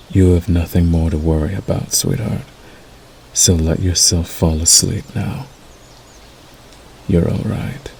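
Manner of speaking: hushed, tender wolf lulling a whelp; soothing werewolf. Acted. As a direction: soothe them gently toward sleep, with soft line endings.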